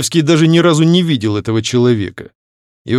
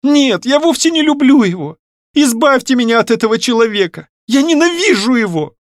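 put-on high-pitched, vehement girlish outburst; desperate plea; 13 semitones higher, 3 dB louder than usual. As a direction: daughter begging her father for rescue. Distraught daughter begs to be saved. (отчаянно, высоким голосом)

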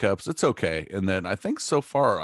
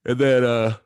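talking through a big yawn; 6 semitones higher, 5 dB louder than usual. Real, not acted. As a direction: yawn mid-sentence, words stretched. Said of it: "He's tired".

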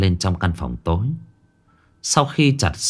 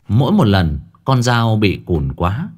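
ordinary delivery, nothing marked

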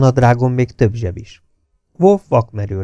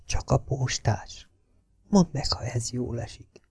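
slow, much softer whisper; hushed and slow under the breath; 2 semitones higher, 13 dB quieter than usual. A narrator's reading. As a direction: whisper it close so no one hears. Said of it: "suttogott Szonya".

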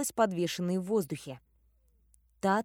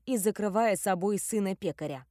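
usual speaking voice

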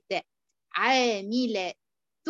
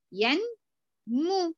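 ordinary delivery

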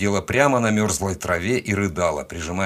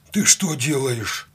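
higher, slow, stunned question; bewildered; 6 semitones higher, slower than usual. A narrator's reading. Cossack father watching the rescuer press his son's ribs, bewildered. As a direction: ask it at a loss, drawn out and raised. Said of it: "растерянно спросил казак".

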